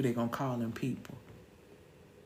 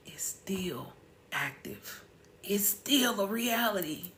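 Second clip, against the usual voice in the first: hushed whisper; 5 semitones higher, slower than usual. Real, not acted. Telling viewers the song is still true, whispered. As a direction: drop to a breathy whisper for the truth. Hushed point about a lasting injustice.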